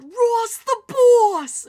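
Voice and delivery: loud, high, drawn-out holler; stretched-out rowdy fan yell. Retold or imitated. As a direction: re-enact yelling to a player at warm-ups. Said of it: "and so I yelled".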